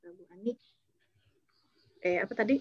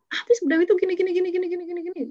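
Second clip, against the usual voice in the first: high, louder, rattling off grievances; whiny complaint; 8 semitones higher, 3 dB louder, much faster than usual. Retold or imitated. Upset colleague venting about Bu Dewi, high and loud. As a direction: pile up the complaints in a rush.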